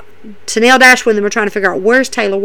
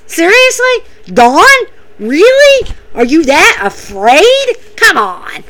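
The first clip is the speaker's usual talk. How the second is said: mocking disbelief; 6 semitones higher, slower than usual. Real, not acted. Mocking Don's fear.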